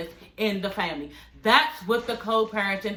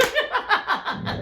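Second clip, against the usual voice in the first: high laugh; 9 semitones higher than usual. Real, not acted. Laughing at own joke.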